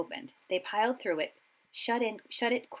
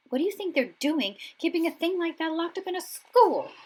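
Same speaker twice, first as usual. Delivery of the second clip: put-on higher, shaken boyish tone; Ron's voice; 10 semitones higher, 6 dB louder than usual. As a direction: still rattled. Ron stunned after the dog.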